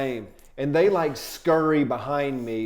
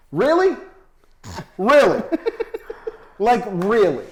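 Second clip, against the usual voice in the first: high, loud incredulous repeats; exasperated disbelief; 6 semitones higher, 4 dB louder than usual. Retold or imitated. Scolding the sweaty feature walking out, incredulous. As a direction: hit him with it, each one sharper. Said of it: "I look at him like".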